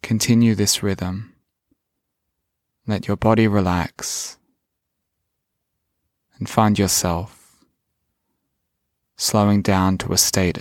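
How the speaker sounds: slow, calm meditation voice; guided meditation. Acted. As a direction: guide the listener gently inward.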